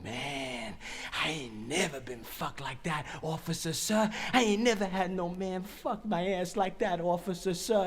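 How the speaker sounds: soft fake admiration; phony flattery. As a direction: lay on hushed, insincere awe. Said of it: "real phony like".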